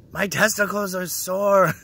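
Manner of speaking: high, loud mock complaint; hammed-up childish cry of pain; imitated hurt child. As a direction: whine it like a little kid, on the edge of laughing. Replays his childhood cry of pain.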